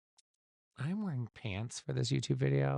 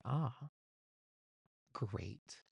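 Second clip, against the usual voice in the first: underwhelmed retold reaction; 8 dB quieter than usual. Retold reaction to grim news.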